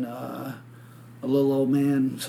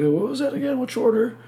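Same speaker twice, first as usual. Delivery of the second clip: much higher-pitched retold question; feigned cluelessness; 10 semitones higher than usual. Acting out his question to the clerk, feigned cluelessness. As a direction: put on a high, questioning voice.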